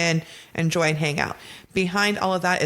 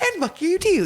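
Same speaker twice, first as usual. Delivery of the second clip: mock sweet; 12 semitones higher than usual. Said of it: "a nice, like".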